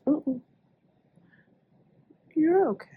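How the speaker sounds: high, sing-song soothing for the dog; comforting the dog in a high coo. Real, not acted. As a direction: coo at the shivering puppy.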